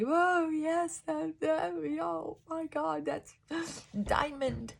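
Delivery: high, breathless wonder with a gasp; reliving a thrilling moment, gasping in awe; amazed delight. Retold or imitated. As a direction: gush like you just found treasure. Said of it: "I was just like".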